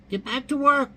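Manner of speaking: very high, loud parrot squawk. Acted. Parrot bossing the handyman, loud high squawk. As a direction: bark the punchline as a bossy parrot. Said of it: "The parrot looks at the guy and says".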